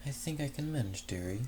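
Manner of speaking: a hint of a grand dame, softly; fancy exotic lady. Rescued mare reassuring her rescuer, lightly fancy and soft. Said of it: "surprisingly fancy yet exotic tone".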